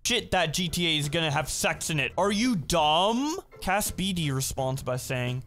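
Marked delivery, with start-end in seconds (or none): 2.2-3.4 slow, higher taunting jab; mocking. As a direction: sneer it out like asking an idiot. Mocking a dumb tweet.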